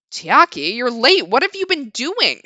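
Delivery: chiding manager. Acted. Mister Mino chides narrator.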